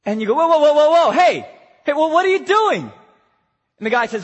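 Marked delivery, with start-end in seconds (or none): 0.3-2.8 loud, high, urgent shout; alarmed calling out. Acted. Rescuer to man walking back to river, alarmed shout. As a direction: holler to stop him in his tracks.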